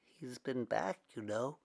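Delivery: hushed and secretive. Acted. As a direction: lean in and keep it secret. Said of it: "Elihu whispered".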